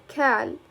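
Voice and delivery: قال turned into كال, opening on k; dialect k pronunciation. Shown as a demonstration. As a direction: say كال with a k, "kal". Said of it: "You pronounce like".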